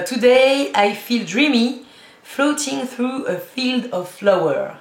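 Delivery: slow, dreamy put-on English accent, like a perfume ad. Reading gift shower gel names to viewers, in a hammed-up English accent. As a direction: read the product name in an exaggerated English accent, drawn out. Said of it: "mon accent anglais".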